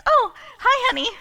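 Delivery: high, loud, caught off guard; mock surprised hello; imitated husband's greeting. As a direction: greet your wife as if just noticing her. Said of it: "He goes".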